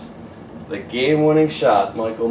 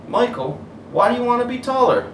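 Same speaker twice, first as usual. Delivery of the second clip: higher, gentle fatherly concern; caring dad voice; 12 semitones higher, 4 dB louder than usual. Acted. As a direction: ask like a worried dad.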